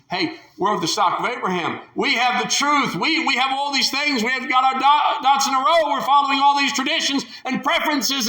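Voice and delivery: high, loud self-satisfied brag; mocking the self-righteous, boastfully; smug boasting. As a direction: brag like a proud Pharisee.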